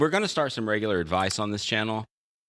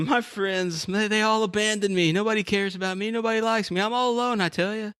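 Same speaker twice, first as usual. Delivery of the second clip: high whiny self-pity, climbing; whining jumper; 10 semitones higher than usual. Retold or imitated. Jumper whines to the passerby that he's alone, high whining. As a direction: wail that nobody cares.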